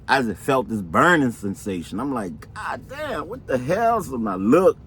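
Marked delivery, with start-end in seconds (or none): 2.5-4.0 high-pitched exclamation of disbelief; retold bewildered shock. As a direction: act out sudden puzzlement at a burn. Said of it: "I didn't say it like that".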